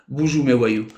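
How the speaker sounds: ordinary talk, nothing marked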